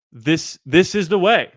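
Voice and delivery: slow, solemn Mandalorian creed, lightly voiced; Mandalorian quote. Host quoting The Mandalorian, a hint of solemn creed. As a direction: intone it like the bounty hunter's motto. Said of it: "Shout out to The Mandalorian".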